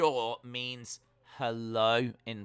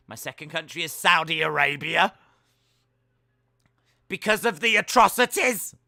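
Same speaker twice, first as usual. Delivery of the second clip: louder and higher, bursting out the punchline; mock proud declaration, much louder; punchline blurt; 4 semitones higher, 9 dB louder than usual. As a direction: belt the last line like a proud boast.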